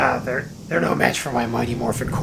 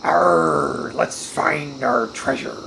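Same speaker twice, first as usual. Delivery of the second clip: low, slow pirate growl; 8 semitones lower, much slower than usual. Acted. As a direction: arr it out like a pirate. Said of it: "you don't like my pirate voice?".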